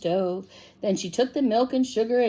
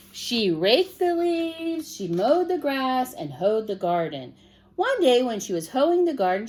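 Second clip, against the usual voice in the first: usual speaking voice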